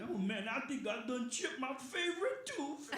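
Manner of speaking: high, loud, drawn-out cartoon lament; slow, high comic wail of a character; goofy character voice. Ad-libbed cartoon character line.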